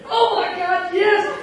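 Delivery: high-pitched excited squeal; high, breaking-out exclamation. A narrator's reading. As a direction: squeal it with amazed delight. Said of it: "And then Janie squealed".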